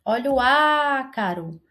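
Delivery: "ácaro" with a long, held opening "á"; stressed first syllable. Shown as a demonstration. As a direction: stretch the "á" at the start of "ácaro". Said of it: "esse A aqui".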